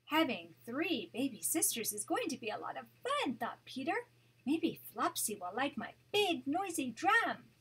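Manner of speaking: gentle read-aloud storytelling voice; storybook narration for a grandchild; story narration. A narrator's reading. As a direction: read it like a bedtime picture book. Grandma reads picture book aloud.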